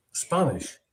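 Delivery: ordinary talk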